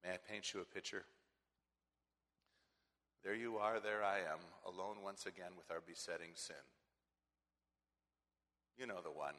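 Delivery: quiet, intimate sermon voice; hushed storytelling. Acted. Preacher painting a temptation scene, quiet and close. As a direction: draw them in softly.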